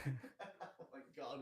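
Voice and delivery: breathless laugh. Real, not acted. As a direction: gasp it through laughter.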